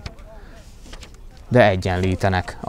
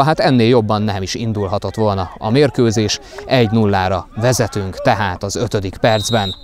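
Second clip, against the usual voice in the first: usual speaking voice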